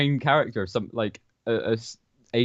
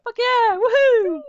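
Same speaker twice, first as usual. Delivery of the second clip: very high, loud squeaky cheer; gleeful horror cheer; 7 dB louder, much slower than usual. Pretend. Brimstone horror cheering mid-battle, high giddy whoop. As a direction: whoop like a giddy little demon.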